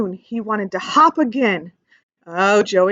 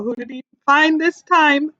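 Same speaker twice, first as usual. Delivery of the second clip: usual speaking voice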